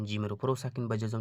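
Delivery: ordinary talk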